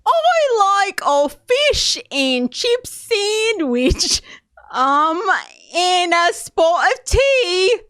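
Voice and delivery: high, slow, overblown fake British voice, half yelled; Queen's English accent. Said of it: "It's the Queen of England accent".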